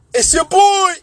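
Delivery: very high, loud rapper shout-out; hammed-up stage call to the crowd. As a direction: holler it like signing off a concert. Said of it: "Jay-Z ends his concert like".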